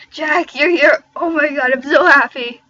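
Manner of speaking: high, loud, delighted exclamation; thrilled and squealing a welcome; happy scream. Acted. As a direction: cry out in joyful greeting. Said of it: "screamed happily".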